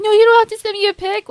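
flustered Gojo. Retold or imitated. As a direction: blurt it out embarrassed.

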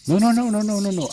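high, loud burst of no's; real panicky protest; alarmed refusal. Real, not acted. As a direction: blurt it out in sudden alarm. Refusing a quest accepted by accident.

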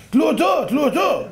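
high, insistent chanted demand; acted demand. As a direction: chant it like an excited crowd, pitched high. Crowd's chant versus the friends' pleas. (דרישה קולנית בקול גבוה)

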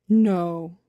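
low, loud, considered bumblebee voice; deliberate before pronouncing it; slow deliberation. A narrator's reading. Bumblebee ponders the boy's question, slow and deliberate. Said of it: "he said slowly".

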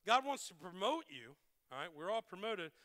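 ordinary talk

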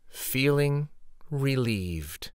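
usual speaking voice

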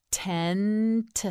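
"Tent" slowly, held n and separate final t; stretched out. Shown as a demonstration. Teacher models for learner, "Tent" stretched slowly. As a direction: draw out "Tent" and release the last t on its own. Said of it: "You say it".

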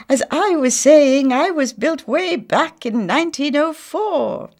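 high, loud lofty boast; proud grand lady. A narrator's reading. Missus Millefleur insisting on her age, high and lofty. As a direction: puff herself up.

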